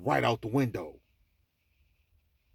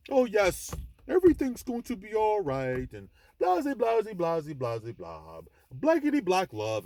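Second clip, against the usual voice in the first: mock-soothing, high and loud, sliding down; babying sweet-talk; 7 semitones higher, 4 dB louder than usual. Man mocking how men coddle women, babying sweet-talk. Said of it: "we gotta boo-boo kitty these women".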